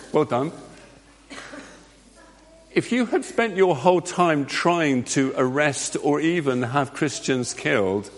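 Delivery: normal talking voice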